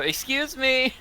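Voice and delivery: high, polite interjection to push through; high-pitched excuse me. Acted. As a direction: pipe up high to get past a crowd. Hazel nudges into the group.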